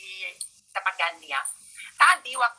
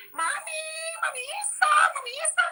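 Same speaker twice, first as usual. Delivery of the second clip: imitated child fan. Online kids calling to Mami, high-pitched imitated child voices. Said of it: "ada anak-anak online".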